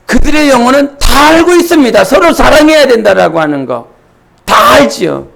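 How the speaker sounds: high, insistent pulpit voice; impassioned preaching. Acted. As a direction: proclaim it to the whole congregation. Preaching at raised intensity.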